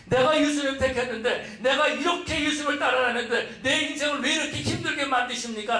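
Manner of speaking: imitated reproach, pitched well up; resentful complaint. Follower blaming God for a hard life, resentful. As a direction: build a high, insistent mock lament to God. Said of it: "라고 하면서 원망하고 있다라는".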